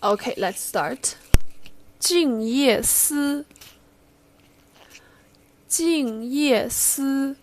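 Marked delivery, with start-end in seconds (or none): 2.0-7.4 slow, careful poem reading; slow model reading of a Chinese poem; slow recital model. Shown as a demonstration. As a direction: read it slowly for students to repeat. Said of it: "ask you guys read after me".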